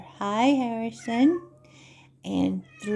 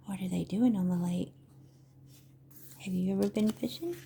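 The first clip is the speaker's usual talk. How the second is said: ordinary delivery, nothing marked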